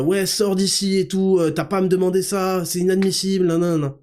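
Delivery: imitated angry shouting; imitating a yelling teacher. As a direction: play the furious prof throwing him out, half in jest. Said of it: "Il commence à me hurler dessus".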